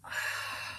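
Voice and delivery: long airy exhale; releasing sigh. Real, not acted. Guide settling listeners into stillness, soft releasing sigh. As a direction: let out a soft, voiceless breath. Releasing breath after warm-up stretches.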